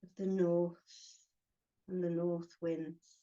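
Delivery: short phrases with long pauses between; unhurried meditation guide, giving each phrase room to settle; guided meditation. Acted. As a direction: guide them gently inward, phrase by phrase.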